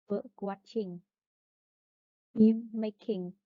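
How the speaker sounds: "bird watching" and "film making" with the first word carrying the weight; first-part stress. Shown as a demonstration. Teacher showing noun plus ing compounds, "bird watching", "film making" stressed on the first word. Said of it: "is main stress on the first part".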